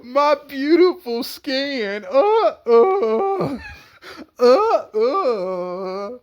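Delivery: very high, wailing cry over her skin; hammed-up sobbing wail; mock wailing. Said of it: "Dana was just crying about her beautiful skin".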